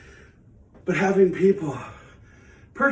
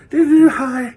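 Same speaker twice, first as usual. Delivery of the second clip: sing-song greeting; 10 semitones higher, 9 dB louder, slower than usual. Real, not acted. Walking to camera for water.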